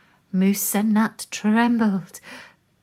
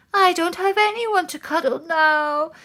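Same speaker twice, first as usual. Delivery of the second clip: sobbing; 10 semitones higher than usual. Acted. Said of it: "sobbed Moose".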